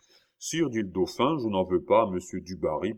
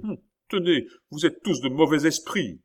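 higher, louder put-on rage; feigned fury; 7 semitones higher, 4 dB louder than usual. King scolding his ministers, feigned furious cry. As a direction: play at being furious, shouting. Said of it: "cria le roi en jouant la fureur".

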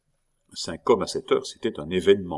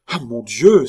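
loud, raised cry of dread; a burst of shock, much louder; alarmed cry; 4 semitones higher, 11 dB louder than usual. A narrator's reading. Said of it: "s'écria Remy".

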